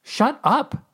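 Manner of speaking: loud high snap; sharp. A narrator's reading. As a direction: cut him off sharply. Macy cuts him off.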